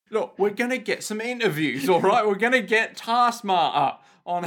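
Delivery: broad put-on English accent; mock English geezer laying out a plan; hammed-up pan-English accent. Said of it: "talk like a couple of English people".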